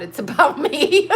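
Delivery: can't hold the laugh in while reading the tweet; laughing out loud. Real, not acted. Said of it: "I can't stop".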